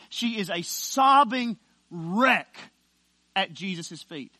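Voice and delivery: drawn-out, weighty preacher delivery; emphatic preaching. Acted. Preacher picturing the woman weeping at Jesus' feet, emphatic preaching. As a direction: preach it slowly, landing hard on key words. Preacher builds to full force.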